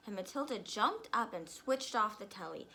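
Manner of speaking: usual speaking voice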